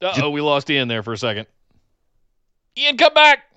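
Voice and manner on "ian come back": raised, higher call to someone who has vanished. Real, not acted. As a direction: call him back, half joking. Host calls out to dropped guest.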